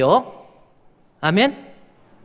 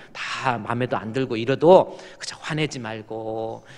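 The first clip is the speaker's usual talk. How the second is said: ordinary talk, nothing marked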